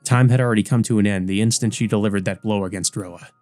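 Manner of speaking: usual speaking voice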